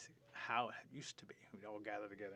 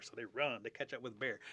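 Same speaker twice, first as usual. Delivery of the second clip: plain reading voice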